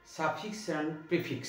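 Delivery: slow topic announcement. Shown as a demonstration. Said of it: "আমাদের পড়ার বিষয় হলো".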